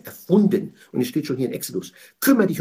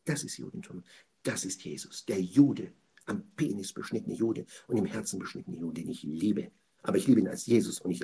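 much softer, lower, almost whispered; hushed confession; 7 semitones lower, 7 dB quieter than usual. Real, not acted. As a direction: drop to a near whisper. Preacher drops to intimate confession. (leise, fast geflüstert)

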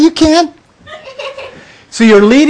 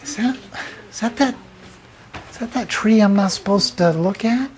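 naive Eve; 3 semitones higher, 9 dB quieter, faster than usual. Retold or imitated. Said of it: "She's kinda going through".

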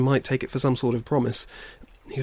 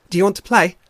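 high, loud, eager otter voice; playful otter; 7 semitones higher, 9 dB louder, faster than usual. Acted. Shoreback invites the bear cubs to play, bright and loud. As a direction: pipe up loudly as a friendly otter.

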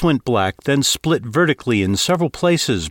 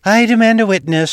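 high, loud, fed-up declaration; exasperated demand; 11 semitones higher, 6 dB louder than usual. A narrator's reading. Victor accusing the captain before the crew, exasperated loud demand. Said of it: "she declared with exasperation".

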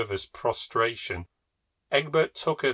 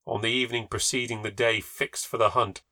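usual speaking voice

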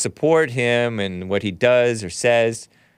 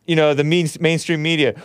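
normal talking voice

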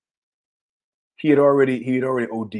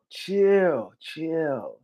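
put-on soft, drawn British-Southern accent; half-British, half-Southern accent; 3 semitones higher, 5 dB quieter than usual. Revived friend to paramedics, in odd British-Southern accent. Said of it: "he always had this weird accent".

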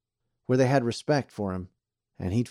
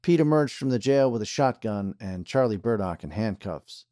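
usual speaking voice

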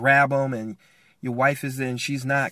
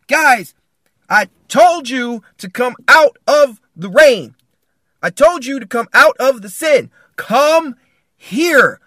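loud, high, emphatic scolding call; stern shouting; 7 semitones higher, 10 dB louder than usual. Acted. Father in the cave scolds family, stern shout. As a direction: shout it firmly, hammering each word. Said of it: "you're in that cave, and you're saying".

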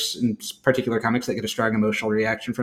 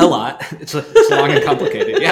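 laughing through the words, voice jumping higher; 5 semitones higher, 5 dB louder than usual. Real, not acted. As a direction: chuckle while joking it's complicated. Co-host teases about long history.

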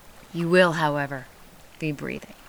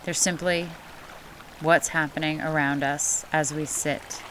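guided meditation; 4 dB quieter than usual. Acted. Leads guided breathing meditation.

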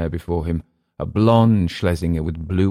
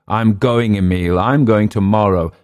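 loud insistence; 4 semitones higher, 7 dB louder than usual. A narrator's reading. Drunk Clift insisting.

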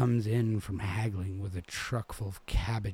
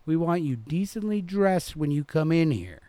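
raised, loud scolding manager; stern manager; 9 semitones higher, 8 dB louder than usual. A narrator's reading. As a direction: lay down the rule to the girls. Manager lectures girls on dress.

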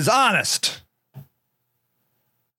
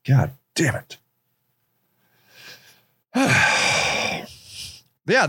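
ordinary delivery

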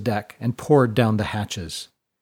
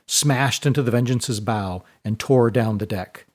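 ordinary delivery

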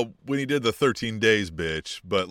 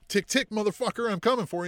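mock menacing rival; 9 semitones higher than usual. Rival voiced threatening MJF.

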